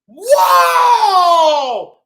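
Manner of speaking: loud whoa; mock amazement. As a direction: gasp at the costume. Pete amazed at costume.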